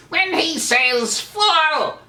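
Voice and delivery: high, enraged cartoon villain; Skeletor. Shown as a demonstration. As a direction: draw it out in a villain's high-pitched rage. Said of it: "it's usually out of rage".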